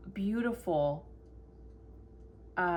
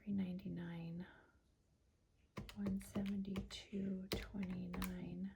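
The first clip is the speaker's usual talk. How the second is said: whispering; 11 dB quieter than usual. Real, not acted. Whispering while entering amounts.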